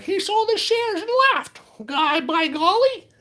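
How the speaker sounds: very high, drawn-out squeaky mock outrage; mock alarmed followers. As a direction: imitate panicky accusers in a slow falsetto. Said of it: "people are probably gonna be like".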